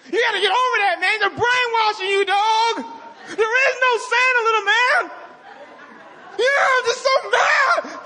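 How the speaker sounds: parodying a mad atheist, shouting and laughing; mock angry atheist. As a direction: rail against Santa like a furious skeptic. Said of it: "I'm like".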